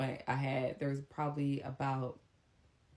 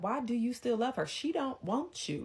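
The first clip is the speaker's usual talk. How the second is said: mom's challenge; 9 semitones higher than usual. Retold or imitated. Mom voiced.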